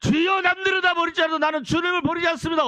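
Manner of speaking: high loud boastful vow; proud Peter. Acted. Peter boasting to the Lord, high loud vow. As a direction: swear it with proud bravado.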